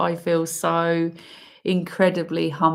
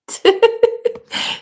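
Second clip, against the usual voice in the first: high, unvoiced laugh of overwhelm; emotional laugh; 6 dB louder than usual. Real, not acted. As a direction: laugh through welling emotion. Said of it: "Sorry, I'm really emotional".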